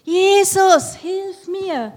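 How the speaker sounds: very high, very loud desperate scream. Acted. Blind beggar screaming over the crowd to Jesus. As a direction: scream it with all your strength. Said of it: "noch mal viel lauter geschrien".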